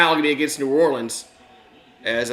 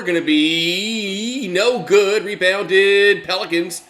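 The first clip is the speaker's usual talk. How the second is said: play-by-play; 4 semitones higher, 5 dB louder than usual. Acted. Live play-by-play of game.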